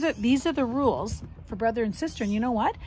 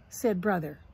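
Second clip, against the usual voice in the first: ordinary delivery, nothing marked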